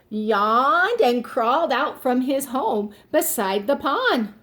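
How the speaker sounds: ordinary delivery, nothing marked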